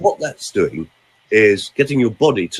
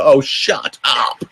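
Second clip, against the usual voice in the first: annoyed listener; much slower than usual. Retold or imitated. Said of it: "most people on the planet will go".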